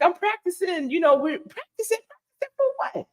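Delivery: high, squeaky fumbling excuse; caught-out teen, voice jumping high; flustered excuse. Retold or imitated. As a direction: stammer out a cover story, caught.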